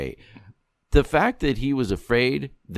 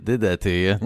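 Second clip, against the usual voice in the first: ordinary delivery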